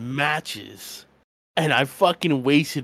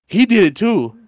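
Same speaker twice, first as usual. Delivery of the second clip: loud, high tattletale cry; tattling; 8 semitones higher, 10 dB louder than usual. Retold or imitated. Younger cousin ratting out the teller, loud mock tattling. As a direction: imitate a kid ratting someone out. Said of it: "he fucking ratted you out".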